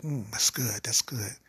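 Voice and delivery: soft, whispered moan of pleasure; breathy whisper. Acted. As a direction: purr it in a whisper. Woman moaning in pleasure.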